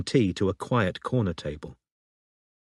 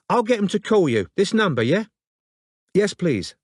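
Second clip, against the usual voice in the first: higher character voices on the phone; voiced phone dialogue; 6 semitones higher than usual. A narrator's reading. Robin and the London woman on the phone, voiced higher. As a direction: play both women in the call.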